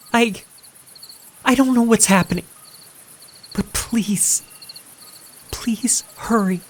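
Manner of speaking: high, pleading panicked woman; frightened girlfriend calling for help; panicked young woman. Acted. April's panicked 911 call.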